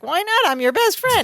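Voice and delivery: high, hurt friend, lightly voiced; rising indignant best friend, mildly hammed; Marianne. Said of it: "Marianne's like".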